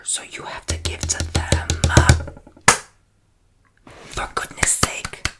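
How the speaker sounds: loud emphatic whisper; whisper shouting. Real, not acted. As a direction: push the whisper hard and loud. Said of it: "Yes, I'm whisper shouting at you".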